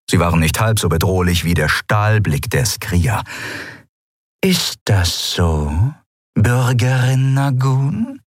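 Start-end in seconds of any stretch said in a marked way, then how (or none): 4.4-8.2 very slow, icy challenge; menacing captain. Acted. Pirate captain coldly challenging Endriel, very slow and icy. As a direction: let the threat hang.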